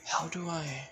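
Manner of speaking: soft, breathy thinking aloud to herself; hushed, puzzled inner voice; inner thoughts. A narrator's reading. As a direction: whisper it like a private thought. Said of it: "that's her thinking".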